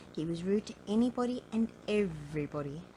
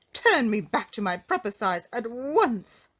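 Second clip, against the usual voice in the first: loud, raised-pitch indignant demand; spluttering rage; 5 semitones higher, 9 dB louder than usual. A narrator's reading. Shrunken Mister Uppity to the goblin, spluttering in a rage. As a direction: demand it loudly in a storybook rage. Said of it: "spluttered Mister Uppity in a rage".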